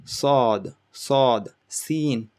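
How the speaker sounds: sod rounded, seen spread. Shown as a demonstration. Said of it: "your mouth is little bit round".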